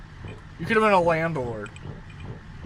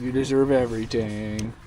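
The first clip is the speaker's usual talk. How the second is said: ordinary talk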